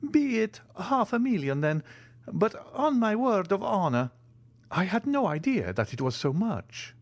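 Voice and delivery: put-on high, earnest old major voice; the Italian major. A narrator's reading. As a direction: protest honestly, hand on heart.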